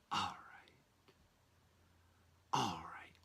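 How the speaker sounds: whispered all right; a private whispered warm-up. Shown as a demonstration. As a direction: breathe it out with no voice.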